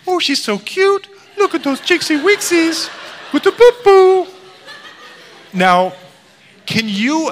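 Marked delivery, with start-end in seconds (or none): 0.1-4.3 put-on very high, loud gushing over a baby; hammed-up falsetto cooing; cooing baby talk. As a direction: squeal it in mock baby talk. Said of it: "he instantly goes".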